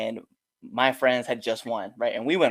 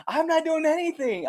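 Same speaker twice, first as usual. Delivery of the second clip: high-pitched mock denial; protesting boyfriend. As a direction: imitate a man caught out, voice way up.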